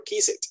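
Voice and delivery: kiss it in two syllables for kissed; wrong -ed pronunciation. Shown as a demonstration. Teacher warning students, kissed mispronounced as kiss it. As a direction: add a vowel so kissed becomes kiss it. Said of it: "no me vayan a decir".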